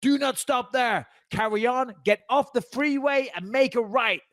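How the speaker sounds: high, slow megaphone orders; cop over the loudspeaker. Retold or imitated. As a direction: bark orders at the driver through a speaker. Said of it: "he says through the speaker again".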